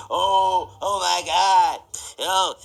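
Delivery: sassy voice. Retold or imitated. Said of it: "I gotta put on my sassy voice".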